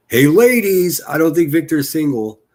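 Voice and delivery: high, loud flirty call to the ladies; loud teasing hey ladies; teasing announcement. Acted. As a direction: hype it up like a wink to the viewers. Teasing female viewers.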